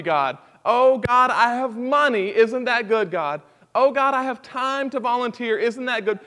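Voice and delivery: loud, proud mock bragging to God; hammed-up self-congratulating prayer; boastful prayer. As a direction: show off to God like a smug child. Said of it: "We don't stand before God and say".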